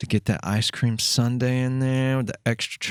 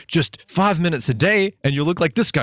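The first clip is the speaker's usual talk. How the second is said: higher, hyped-up ad voice; mock infomercial pitch; 6 semitones higher than usual. Host mimicking a TV fitness ad, hammed-up pitch. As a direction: sell it like a cheesy fitness ad. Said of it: "it's like".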